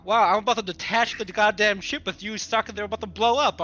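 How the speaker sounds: ordinary delivery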